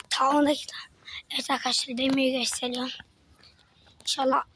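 hushed whisper to the camera. Real, not acted. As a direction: whisper so nobody hears. Sneaking a look at a car at night.

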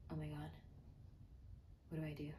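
low whispered dread, retold; hushed mock panic. Freezing while packing the suitcase, hushed retold panic. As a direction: whisper it, stunned and helpless.

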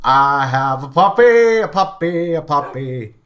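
excited sing-song. Real, not acted. Celebrating owning a puppy.

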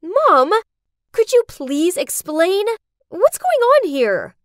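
loud, high demanding shout, slowing at the end; screaming at mom. Acted. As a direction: yell it at her in disbelief. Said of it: "I screamed".

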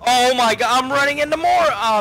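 high loud frantic yelling; panicked shouting. Real, not acted. Running into monsters.

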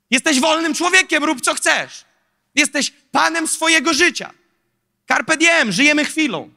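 lifted, mocking voice of the world's slogan; mock worldly slogan.

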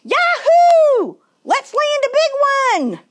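high, loud whoop of joy; loud exclamation. A narrator's reading. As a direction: holler it out, thrilled to be fishing. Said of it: "every time he exclaimed".

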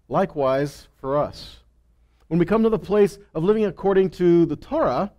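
normal talking voice

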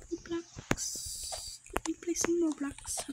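hushed, voiceless whisper. Real, not acted. Player talking about playing Roblox, whispering. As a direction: whisper it very softly.

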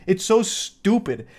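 usual speaking voice

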